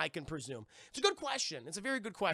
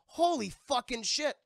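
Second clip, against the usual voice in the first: loud, higher stunned swearing; acted shock; 7 semitones higher, 6 dB louder than usual. Playing Barbosa seeing Jack turned skeletal, acted shock. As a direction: swear in mock astonishment.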